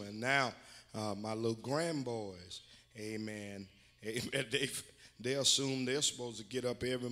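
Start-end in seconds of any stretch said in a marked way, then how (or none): none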